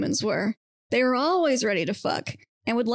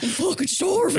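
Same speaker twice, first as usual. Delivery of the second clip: exasperated starving whine; 7 semitones higher than usual. Acted. Mermaid voiced, starving complaint.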